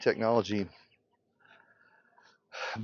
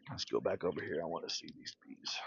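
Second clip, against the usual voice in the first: softer, lower murmur; hushed aside; 8 semitones lower, 15 dB quieter, faster than usual. Real, not acted. Moving to the hives, softer and lower. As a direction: drop the voice near the bees.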